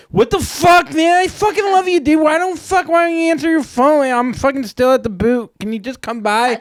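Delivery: put-on loud, very high, whining drunk rant; drunken voicemail. Man phoning a woman two hours later, imitated drunken wailing. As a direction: wail it like a desperate drunk, way up high. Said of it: "left a message drunk".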